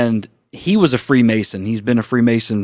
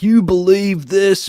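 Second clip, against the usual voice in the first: slow, higher mocking jeer; 8 semitones higher, 3 dB louder, slower than usual. Older coworker ridiculing him in front of everybody, mocking jeer. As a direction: jeer at him for the whole room to hear. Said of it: "making fun of me in front of everybody".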